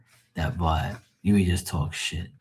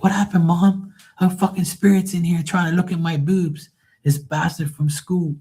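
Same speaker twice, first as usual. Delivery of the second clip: higher, lightly voiced kid; a hint of a puzzled child asking mom; mimicking her kids; 9 semitones higher than usual. Children asking mom about the spirit, lightly voiced kid. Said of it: "her children be like".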